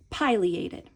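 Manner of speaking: pileated as "PIE-lee-ay-tid"; long-i pileated. Shown as a demonstration. Teacher choosing a pronunciation to work through, long-i pileated. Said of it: "let's go with the pronunciation".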